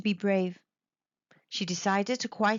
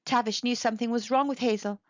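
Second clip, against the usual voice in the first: plain reading voice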